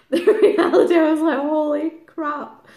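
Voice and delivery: high, loud laughing exclamation. Real, not acted. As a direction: burst out laughing through the words. Laughs at her own realisation.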